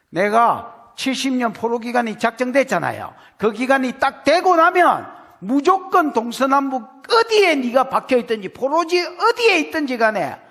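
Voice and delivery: loud, high pulpit preaching; fiery preaching. Acted. Preacher driving home God's promise to the exiles, loud and high. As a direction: preach it at full force.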